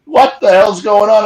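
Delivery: high, loud imitated disbelief; startled question. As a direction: blurt it out as the alarmed brother.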